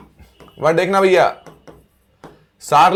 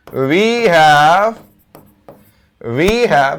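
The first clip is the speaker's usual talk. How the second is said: short phrase repeated with long pauses; deliberate write-along delivery; slow dictation; much slower than usual. Real, not acted. Dictating a sentence while writing it.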